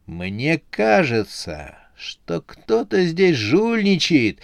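slow, drawn-out taunt, rising high; slowly needling suspicion; mocking. A narrator's reading. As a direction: insinuate it with a jeer, dragging the words. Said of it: "с издёвкой сказал председатель".

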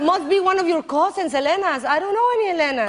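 high, fast nervous denial; mock husband excuse. Husband denying the message to his wife, high and rushed. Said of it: "And he's like".

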